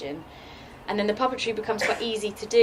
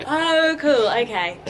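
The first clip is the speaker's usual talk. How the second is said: excited; 7 semitones higher, 9 dB louder, much slower than usual. Retold or imitated. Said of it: "And you're like".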